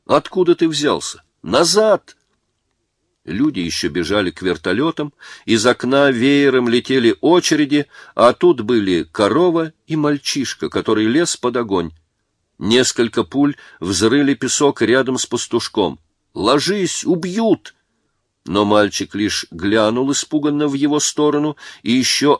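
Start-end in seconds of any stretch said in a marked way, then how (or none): 0.1-2.2 high, alarmed command; urgent shout. A narrator's reading. Soldier orders boy out of gunfire. 16.3-17.8 high-pitched alarmed warning; urgent warning. A narrator's reading. Soldier warns boy under fire.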